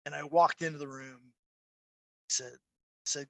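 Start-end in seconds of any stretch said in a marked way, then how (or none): none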